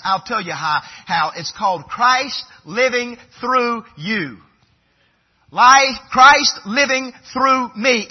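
loud, high, driving sermon voice; forceful preaching. Acted.

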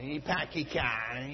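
soft Maine accent, dropping the r's; quiet Maine accent demo. Shown as a demonstration. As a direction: say it like a Down East Mainer. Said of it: "you have to say it with a little bit of an accent".

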